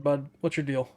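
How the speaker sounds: ordinary talk, nothing marked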